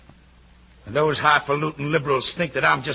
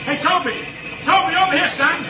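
calling out; 9 semitones higher than usual. Acted. Calling across a crowd.

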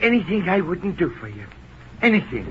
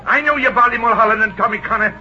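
loud, high tirade; heated outburst building unchecked; furious accusation; 6 semitones higher, 4 dB louder than usual. Acted. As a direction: round on them all, shouting. Jippo's furious accusation of companions.

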